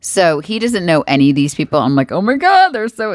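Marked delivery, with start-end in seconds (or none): 2.1-3.1 high-pitched fan gasp of recognition; starstruck gushing. Retold or imitated. Wife spotting her favorite Facebook people, starstruck and excited. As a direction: gush like a fan, pitch leaping up.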